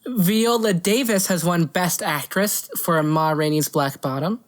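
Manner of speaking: ordinary delivery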